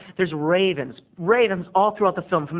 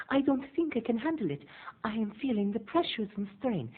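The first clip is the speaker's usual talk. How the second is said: anxious Betty; 6 semitones higher, 8 dB quieter than usual. Retold or imitated. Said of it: "Betty answering agent".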